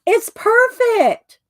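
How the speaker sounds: high, slow mock Robert voice; playful high quoted man; Robert's line. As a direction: squeak it out slowly as Robert. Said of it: "And Robert goes".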